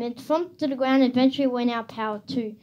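plain reading voice

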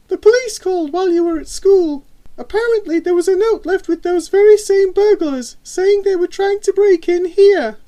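very high, loud mum voice; mother's voice. A narrator's reading. Mother relaying news to her daughter, high and loud.

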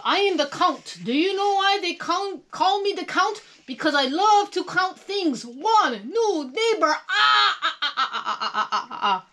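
high, loud theatrical voice with staccato laugh; the Count. A narrator's reading. A counting puppet introduces himself to Molly, in grand character voice. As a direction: declaim proudly, ending in "ah, ah, ah" laughter. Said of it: "said the Count".

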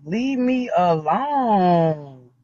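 very slow, stretched plea; long, tired leave me alone; drawn out. Real, not acted. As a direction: drag every word out wearily.

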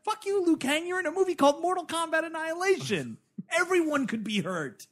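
very high-pitched exasperated rant; mock scolding. Viewer telling off the movie's hero, high mock outrage. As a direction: tell the hero off in a high, comic whine.

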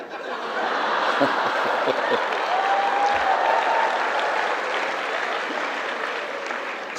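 high breathless laugh; high wheezing laugh. Real, not acted. Laughing at his own story, high wheezing laugh.

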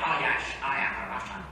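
comic impression of a Scottish film star; Scottish accent whatever the nationality claimed; mock film-star voice, slurring "yes" to "yesh"; Sean Connery. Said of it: "Sean Connery, when he was a Russian".

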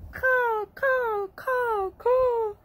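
high, loud crow caws; crow cawing. Acted. Teacher reading a bird book to children, high cawing. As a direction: caw out like a calling crow.

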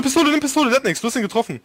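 high, urgent callouts; panicking gamer. Real, not acted. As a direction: yell advice in a frantic clutch. Urging teammate during clutch.

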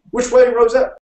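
high, loud shouted question; shouting. A narrator's reading. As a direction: yell for directions over galloping horses. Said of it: "shouted William".

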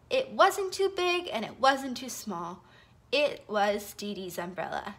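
ordinary delivery

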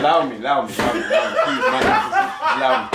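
loud laughing begging; laughing pleading. Real, not acted. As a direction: laugh while begging them to stop. Laughing pleading at friends' prank.

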